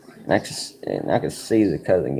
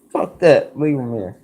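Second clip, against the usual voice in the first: fed-up buddy; 9 semitones higher, 4 dB louder than usual. Retold or imitated. Buddy gets elbowed, gives up.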